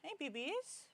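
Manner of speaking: high, cooing pet voice. Real, not acted. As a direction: coax the cat sweetly. Cooing at cats.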